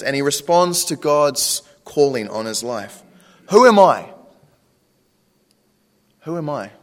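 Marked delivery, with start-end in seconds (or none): 3.5-4.1 loud, high-pitched protest as Moses; incredulous outburst. Acted. Moses answering God's call, incredulous outburst. 6.2-6.7 subdued self-doubt. Acted. Moses doubting himself before God, subdued.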